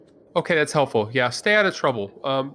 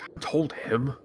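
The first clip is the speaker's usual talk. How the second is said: hushed under-breath grumble, looking away; whispered I-told-you-so; muttered aside; 6 semitones lower, 5 dB quieter than usual. Pretend. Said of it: "kinda look away and be like".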